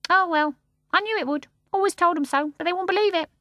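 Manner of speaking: high bird voice, resigned; starling to Mary Poppins, resigned. A narrator's reading. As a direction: shrug it off as a bird.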